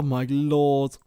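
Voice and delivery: normal talking voice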